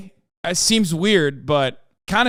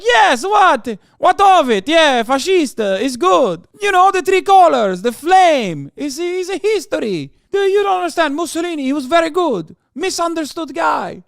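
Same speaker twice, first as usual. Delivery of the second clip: high, loud hammed-up Italian; mock Italian accent; 10 semitones higher, 6 dB louder than usual. Italian asked if they're fascists: high, loud mock Italian accent. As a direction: brag like a proud cartoon Italian.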